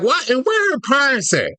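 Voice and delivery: hammed-up shout of disbelief; mock outraged shout. Mock outrage in a comic rant.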